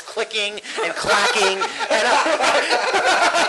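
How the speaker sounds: breaking up with laughter; cracking up at your own joke; laughing. Real, not acted. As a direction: talk through laughter, pitch climbing high.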